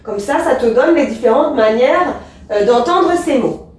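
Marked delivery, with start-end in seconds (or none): none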